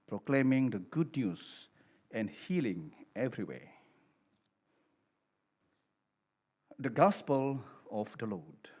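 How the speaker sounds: Gospel proclamation. Acted. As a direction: proclaim it to the whole congregation.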